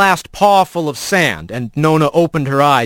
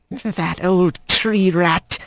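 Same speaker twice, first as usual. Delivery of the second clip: higher, slow grandmother voice in broken speech; old squirrel grandmother; 4 semitones higher, 3 dB quieter, much slower than usual. Acted. Grandmother greeting her grandson, higher and slow. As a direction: coax him like a fond old granny.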